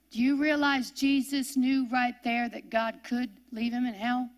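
usual speaking voice

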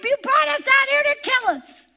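high panicked cry of complaint; a mock panicked outburst at Moses; mock screaming Israelites. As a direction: wail it like a frightened crowd. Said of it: "they start screaming out".

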